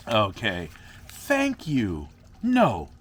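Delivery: normal talking voice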